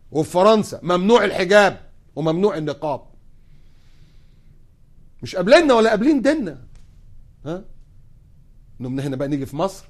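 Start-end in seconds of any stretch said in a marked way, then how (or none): none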